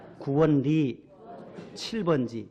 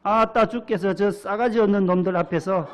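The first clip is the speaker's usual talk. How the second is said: put-on louder, folksy Jeolla accent; Jeolla dialect; 3 semitones higher, 5 dB louder than usual. Pastor reads of enemies in the Psalm, in Jeolla dialect. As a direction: play up the earthy Jeolla dialect. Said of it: "전라도에서는".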